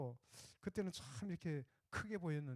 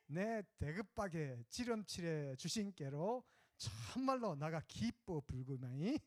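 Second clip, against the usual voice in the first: Jeolla dialect; 3 semitones higher than usual. Pretend. Pastor recites Psalm 23 to the congregation in Jeolla dialect. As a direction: play it like a cheerful Jeolla villager. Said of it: "전라도".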